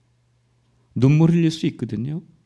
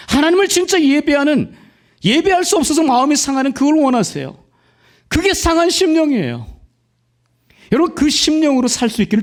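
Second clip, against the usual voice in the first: high, loud impassioned sermon cry; fervent preaching; 12 semitones higher, 5 dB louder than usual. Real, not acted. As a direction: preach it out at full force, rising high. Preaching at full force. (높고 크게 외치는 열정적인 설교)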